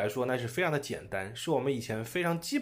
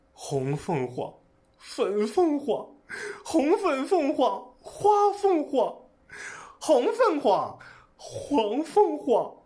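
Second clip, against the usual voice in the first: slow, building higher and louder; mixed emotions; 10 semitones higher, 4 dB louder, slower than usual. Shown as a demonstration. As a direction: swing from joy to despair, slowing down. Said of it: "我就把这两种感觉mix一下吧".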